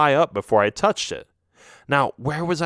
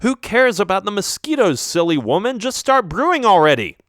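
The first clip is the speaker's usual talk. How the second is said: impatient, louder push; annoyed; 8 semitones higher, 4 dB louder than usual. Acted. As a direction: snap at her to hurry. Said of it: "I got a little angry".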